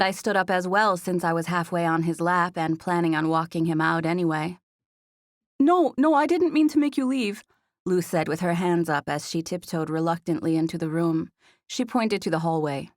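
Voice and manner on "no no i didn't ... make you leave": much higher, louder, hurried-in objection; flustered protest. A narrator's reading. Roommate stopping the guest from leaving, flustered.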